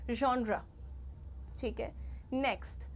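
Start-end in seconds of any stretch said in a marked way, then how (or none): 0.1-0.6 genre as ZHON-ra; soft zh. Shown as a demonstration. Teacher modelling after the sound drill, soft-zh genre. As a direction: start genre with zh. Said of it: "कहना है ज".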